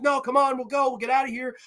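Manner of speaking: high-pitched retold urging; mock urgent pleading. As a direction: coax urgently, voice pitched right up. Acts man urging her to leave.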